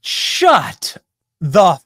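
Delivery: high, drawn-out exasperated yell; angry outburst. Real, not acted. Host reacting to the moderator's clip, angry outburst. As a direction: spit out each word separately, fed up. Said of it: "I done got mad".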